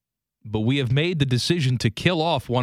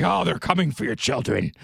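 ominous warning; 3 semitones higher than usual. Retold or imitated. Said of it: "the way they said it was like".

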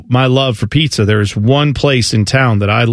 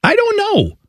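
high squeak; slower than usual. Real, not acted.

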